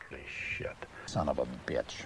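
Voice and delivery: whispered self-directed swearing; whispered curse. Real, not acted. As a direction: breathe it out under your breath. Cursing under his breath.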